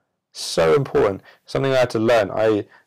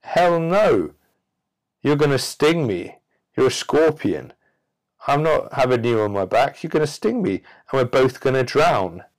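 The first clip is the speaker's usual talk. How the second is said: refusing frog; 5 semitones higher than usual. Acted. Frog refusing to carry the scorpion, high and alarmed. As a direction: recoil like a frightened frog saying no. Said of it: "The frog says".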